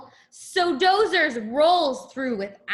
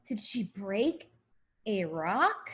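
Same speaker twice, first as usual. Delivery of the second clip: hushed question; 5 semitones lower, 8 dB quieter than usual. Real, not acted.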